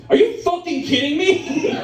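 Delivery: high, indignant mock outburst; outraged disbelief. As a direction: play an incredulous, fed-up moviegoer. Acted outraged moviegoer confronting him.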